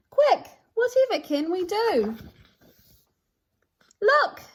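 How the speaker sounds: high, loud urgent call; rising alarm that turns to an excited look. Acted. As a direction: call out to rally the others. Creatures call for a rescue.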